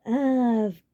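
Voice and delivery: very slow, drawn-out sarcasm; deadpan sarcastic. A narrator's reading. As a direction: drip with sarcasm. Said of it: "he said sarcastically".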